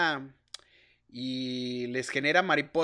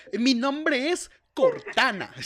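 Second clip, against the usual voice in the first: slow, higher virtual assistant voice; robot assistant voice; 8 semitones higher, 6 dB louder, slower than usual. Retold or imitated. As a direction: speak like Cortana the assistant. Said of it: "tú esperando acá".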